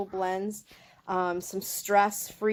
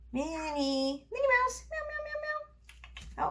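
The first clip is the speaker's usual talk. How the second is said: much higher, slow, soft drawn-out meows; pet-talk calling; 4 dB quieter, slower than usual. Real, not acted. Owner calling her kitten Minnie with soft high meows. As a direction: lure the kitten with gentle mewing.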